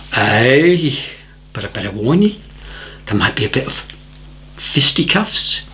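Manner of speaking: understated Scottish warning; Scottish accent. Retold or imitated. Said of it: "deadpan, beautiful Scottish accent".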